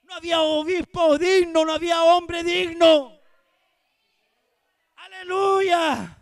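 loud, ringing pulpit cry; fiery Spanish sermon shout; full-force preaching. Acted. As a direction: preach it out to the whole congregation.

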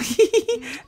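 short high-pitched giggle. Real, not acted. Laughing at own squeal.

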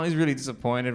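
ordinary talk, nothing marked

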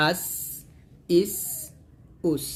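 "আস", "ইস", "উস" ending on a long stream of air; airy hissed seen. Shown as a demonstration. Teacher showing how seen releases air, hissed endings. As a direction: let the breath run out on the s of "আস", "ইস", "উস". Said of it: "অবশ্যই বাতাস বের করবেন".

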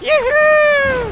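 very high, loud gleeful yell; excited whoop. Real, not acted. Gamer whooping as he dodges, high gleeful yell. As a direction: whoop like on a rollercoaster.

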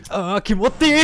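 loud, high excited echo. Retold or imitated. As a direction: shout the word back gleefully. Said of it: "Oh, kimochi, kimochi".

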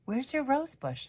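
high, soft curious question; a hint of a doubtful neighbor; Miss Giamani asking. A narrator's reading. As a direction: neighbor gently asks a girl. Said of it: "said Miss Giamani".

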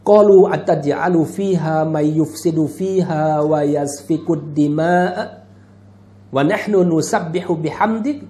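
measured, melodic Arabic recitation; intoned sacred verse; Quran recitation. Acted. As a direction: recite the verse with long drawn vowels.

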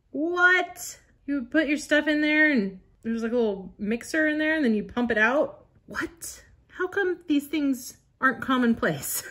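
high-pitched, incredulous wonder; amazed disbelief. Real, not acted. Amazed by an old gadget.

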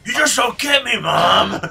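put-on loud whiny teen outburst; angsty teen yell. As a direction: yell like a sulky teenager at mom. Angsty teen yelling at mom.

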